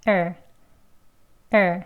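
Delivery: er said weakly, low and short. Shown as a demonstration. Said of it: "약하게, 낮게, 짧게".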